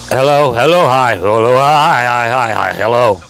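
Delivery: booming hellos to test the mic; loud test greeting. Shown as a demonstration. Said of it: "I'm gonna be loud in it".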